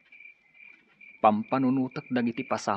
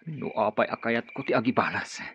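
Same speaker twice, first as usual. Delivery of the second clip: normal talking voice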